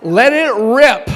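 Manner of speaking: rally the room with a big shout; loud and proud. Real, not acted. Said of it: "Loud and proud".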